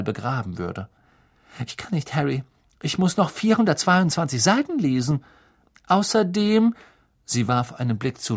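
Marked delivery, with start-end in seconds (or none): 1.7-6.7 put-on raised girl's voice, harried; stressed, studious girl. A narrator's reading. Hermine tells Harry she must keep reading, harried. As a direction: plead in a higher voice.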